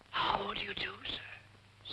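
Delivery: soft whisper. Acted. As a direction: whisper it politely. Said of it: "What are you whispering about?".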